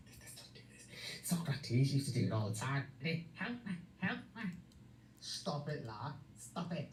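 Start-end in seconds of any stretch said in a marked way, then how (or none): 0.1-1.3 hushed whisper, pulling back. Real, not acted. Backing off from the row, whispered. 3.3-4.4 high mocking whine of help; mock plea. Mocking a housemate with a high mock plea.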